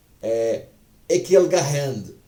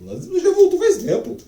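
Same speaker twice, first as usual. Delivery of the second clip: much higher, loud indignant cry; outraged outburst; 7 dB louder than usual. Real, not acted. Teacher venting about nazism's return, loud high outrage. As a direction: burst out in real outrage.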